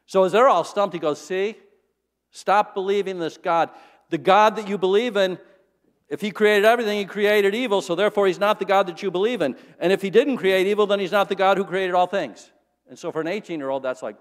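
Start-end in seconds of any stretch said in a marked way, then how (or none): 1.2-12.5 loud, higher hammering argument; triumphant professor. Acted. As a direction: nail the freshmen with his logic. Professor triumphantly arguing to students.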